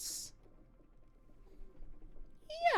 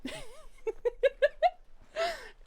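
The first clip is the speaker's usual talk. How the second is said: shrill character cackle, much higher; high cackling laugh. Acted. Alligator cackles after yelling at Tony.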